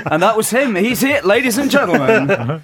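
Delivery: high, loud theatrical announcement. Acted. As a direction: proclaim it to the whole house.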